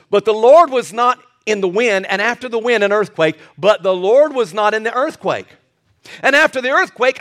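high-pitched pulpit proclamation; full-force preaching. Acted. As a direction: proclaim the scripture to the congregation.

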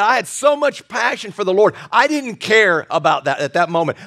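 ordinary delivery

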